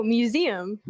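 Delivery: "museum" said as myoo-ZEE-um; standard pronunciation. Shown as a demonstration. Friend on the phone showing own way, standard pronunciation of "museum". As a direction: say "museum" with ee before the um. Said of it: "you say it how you say it".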